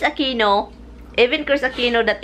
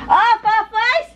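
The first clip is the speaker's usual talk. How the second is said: surprised; 12 semitones higher, 5 dB louder, slower than usual. Real, not acted. Surprised at choice.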